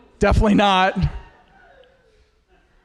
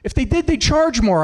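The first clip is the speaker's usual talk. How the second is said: put-on high kid voice, a touch louder; cheeky kid; 8 semitones higher, 4 dB louder than usual. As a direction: deliver it as a smug little punchline kid. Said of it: "He goes".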